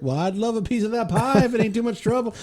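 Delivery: put-on old farmer doctor. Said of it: "He's like".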